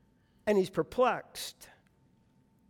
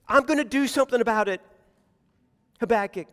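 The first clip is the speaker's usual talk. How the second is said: quick, louder run through the phrase; dismissed promise; 4 dB louder, much faster than usual. Shown as a demonstration. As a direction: rattle it off loudly as a promise for later. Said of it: "it's not like".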